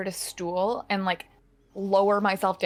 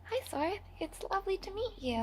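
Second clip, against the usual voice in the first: sweet soft greeting; 10 semitones higher, 10 dB quieter than usual. Acted. Fairy greets drow sweetly.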